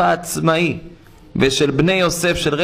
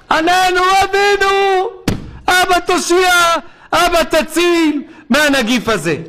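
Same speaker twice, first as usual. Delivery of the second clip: imitated pleading cry; 13 semitones higher than usual. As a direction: cry out like desperate worshippers begging. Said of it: "זעקות, בכיות, תחינות".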